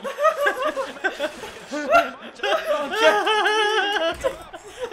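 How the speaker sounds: long, high fit of laughing; helpless laughter. Real, not acted. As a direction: crack up and keep going. Laughing after a close moment.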